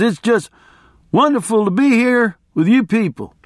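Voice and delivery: high-pitched, worked-up barroom storyteller; boisterous guy telling his wild tale; rambling race fan. Acted. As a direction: hold forth to a crowd in a high voice. Voices rambling biker to crowd.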